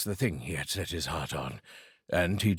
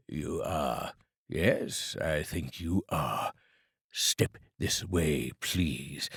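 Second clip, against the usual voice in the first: lower, slow, drawn-out sternness; mock stern; 5 semitones lower, much slower than usual. Scrooge pretending to scold late Bob, slow and stern.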